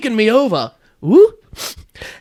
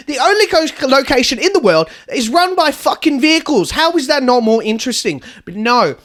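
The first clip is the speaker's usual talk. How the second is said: ordinary talk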